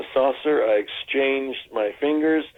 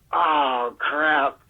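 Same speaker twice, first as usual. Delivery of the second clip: crushed little groan of a reply; deflated; 4 semitones higher than usual. Retold or imitated. Actor deflated after being turned down, crestfallen. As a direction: let the air out, disappointed. Idea rejected, deflated reaction.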